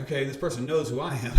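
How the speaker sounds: suddenly keyed up; excited. Retold or imitated. His thought on hearing name.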